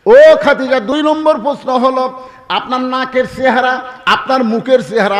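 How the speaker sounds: high, forceful questioning with a loud opening call; dramatic preaching. Acted. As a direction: call out the name, then list each point high. Preacher voices Prophet's question. (উঁচু গলায় ওয়াজের প্রশ্ন)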